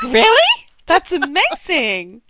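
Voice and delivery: high, loud incredulous "really?", then slowing; amazed reaction. Retold or imitated. Co-host mocks her own gullible belief, mock amazed. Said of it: "because I was like".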